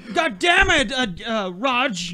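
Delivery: high, loud mock exasperated shout; yelling across the room. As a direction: shout across the house at a family member. Suburban dad yelling.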